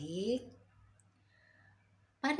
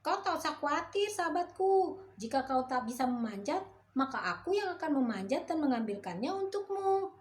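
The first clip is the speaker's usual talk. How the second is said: much higher, louder cocky monkey voice; the monkey reassuring the frog, higher and louder; boastful monkey; 8 semitones higher, 4 dB louder than usual. A narrator's reading. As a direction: promise it big and bright.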